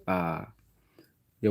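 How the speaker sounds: ordinary delivery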